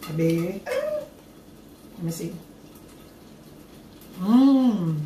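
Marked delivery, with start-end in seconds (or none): 0.7-2.3 high drawn-out tasting voice. Acted. 4.2-5.0 savoring hum. Real, not acted. Savoring the taste.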